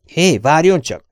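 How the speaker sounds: loud, high, drawn-out call; calling after someone. Acted. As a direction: shout to stop someone leaving. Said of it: "szólt utána a kollégám".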